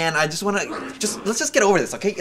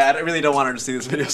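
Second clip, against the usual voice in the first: ordinary talk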